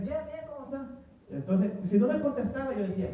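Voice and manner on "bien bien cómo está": a kindly lady answering the secret greeting, voiced high; acting the lady. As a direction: lift the voice high and light to play her. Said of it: "Ella decía".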